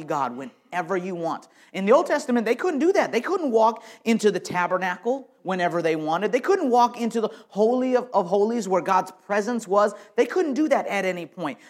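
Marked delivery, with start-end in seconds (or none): 1.7-11.6 louder, higher sermon build; fervent preacher's emphasis; preaching at full force. Real, not acted. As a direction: drive it home from the pulpit.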